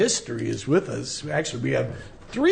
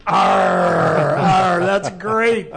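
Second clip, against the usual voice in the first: loud hearty pirate arr; pirate growl; 3 semitones higher, 7 dB louder, much slower than usual. Pretend. Pirate day announced, pirate growl.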